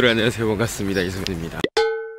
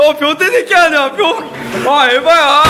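laughing; 6 semitones higher, 3 dB louder, slower than usual. Real, not acted. Laughing at friend's answer.

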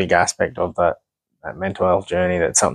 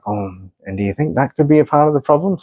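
mock therapist's question, higher pitched; lightly mocking, raised-pitch impression; imitating a therapist; 9 semitones higher than usual. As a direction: put on a higher voice for a quoted question. Acted quote of the therapist lady.

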